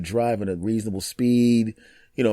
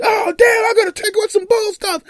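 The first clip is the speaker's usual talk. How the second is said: very high, louder whining voice; whiny complaint; 6 dB louder than usual. Pretend. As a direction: squeal it like a sore loser. Mock-whining about getting ticket.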